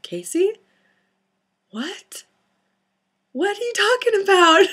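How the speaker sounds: confused mom. Retold or imitated. Mom at the bottom of the stairs, puzzled. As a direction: sound baffled. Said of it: "was like".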